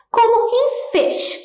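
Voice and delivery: "fish" said high and slow, f sound shown; modelling the word. Shown as a demonstration. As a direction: lift and stretch "fish" to model the f. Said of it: "o nosso F representa o som". ("fish" dito agudo e devagar, mostrando o som f)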